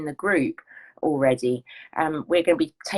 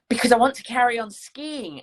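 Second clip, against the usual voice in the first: lightly voiced eager retiree, brighter and a bit louder; eager skier; 6 semitones higher, 5 dB louder than usual. Coach retelling a retired lady's keen wish, mildly excited. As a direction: mimic her keen reason, a touch more lifted.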